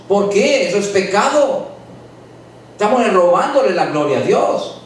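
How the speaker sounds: fervent preaching. Acted. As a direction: preach it out to the whole church. Preaching at full force to congregation.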